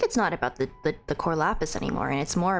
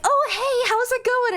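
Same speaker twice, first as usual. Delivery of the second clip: bright, much higher friendly hello; cheery greeting; 4 dB louder than usual. Retold or imitated. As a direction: greet like an eager acquaintance. Retold acquaintance greeting on street.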